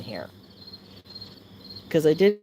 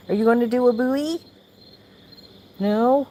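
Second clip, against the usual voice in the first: high, louder pet question; 9 semitones higher, 5 dB louder than usual. Real, not acted. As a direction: coax the cat playfully. Asking the cat.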